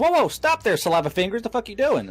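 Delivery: retold loud yell. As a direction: stop a guy touching models with wet fingers. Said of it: "I said it so loudly".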